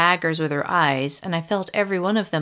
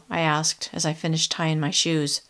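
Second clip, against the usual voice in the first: ordinary delivery, nothing marked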